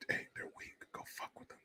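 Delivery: unvoiced sly whisper. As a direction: tempt them in a whisper. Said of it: "their voice is telling them".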